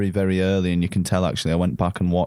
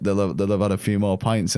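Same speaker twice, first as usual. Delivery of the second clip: ordinary delivery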